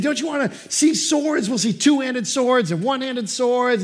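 high, loud, eager coaxing; dad selling the trip to his son, high and eager. Retold or imitated. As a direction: talk a toddler into the trip, bright and pleading.

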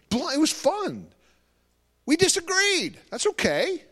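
excitedly high and loud. Real, not acted. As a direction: lift it up with joy. Excited recap of great conversation.

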